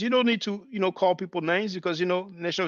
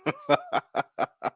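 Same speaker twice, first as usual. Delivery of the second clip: high burst of laughter; 4 dB quieter than usual. Real, not acted. Laughs at the clip.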